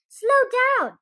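high, loud call. Acted. Turkey Lurkey calling to the hurrying birds, loud. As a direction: call out to stop them.

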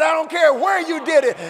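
ordinary talk, nothing marked

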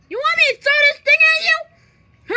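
loud, high-pitched mock threat; worked-up, high and loud challenge; angry threat. Puppet threatens to throw thing.

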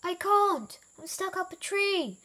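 high, plaintive call; calling from up a tree. A narrator's reading. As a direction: call down for help, pitched high. Wilbur calls from up tree.

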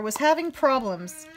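plain reading voice

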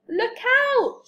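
very high, urgent call; high storybook shout; shouted warning. A narrator's reading. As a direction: warn them in alarm. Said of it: "shouted Henry".

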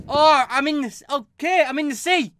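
high, loud startled outburst; sudden surprised yelp. Real, not acted. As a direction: caught off guard, voice jumps up. Startled by sudden scene change.

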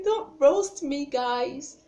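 high, loud, drawn-out mock plea; playful pleading. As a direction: beg slowly and loudly, half joking. Playfully begs viewers for mercy.

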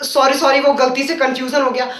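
ordinary talk, nothing marked

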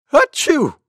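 high, loud acted sneeze; short punchy pretend "achoo"; monkey's sneeze. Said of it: "went Monkey".

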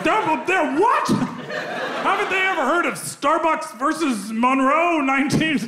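high-pitched mock indignation; sputter, then grandstand like a fake attorney; outraged lawyer. Pretend lawyer to client over coffee refill, outraged.

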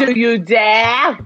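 slow, loud, theatrical dare; dramatic challenge. Acted. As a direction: draw out the dare with booming drama. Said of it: "Or, like".

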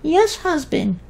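very high, tiny, cheerful fairy voice; fairy wife voice. Acted. The female fairy cheerfully explains to the twins, very high and tiny. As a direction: chirp it sweetly like a giggly little fairy.

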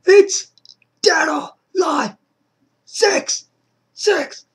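real high screaming excitement; excited screaming. Real, not acted. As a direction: scream it out, overjoyed at the news. Screaming over game news.

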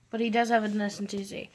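ordinary delivery

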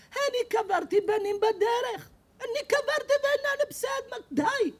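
very high, slow pained shouting; high, slow grieving yell; anguished shout. Retold or imitated. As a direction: cry out his grief in anger. Bereaved neighbor shouting.

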